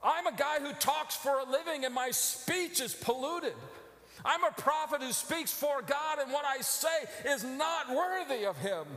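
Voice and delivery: high, loud, pleading cry; full-force anguished shout; anguished preaching. Acted. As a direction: cry out in shame before God. Preacher voicing Isaiah's anguish.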